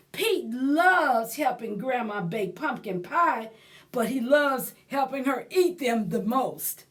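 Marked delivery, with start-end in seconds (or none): none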